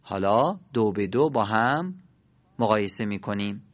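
ordinary talk, nothing marked

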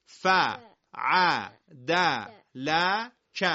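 each syllable on its own, paused between; letter by letter. Shown as a demonstration. Teacher sounding out letters for the class to repeat, letter by letter. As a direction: sound out "ف، ع، د، ل، ک" one at a time. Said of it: "همه با هم بگید".

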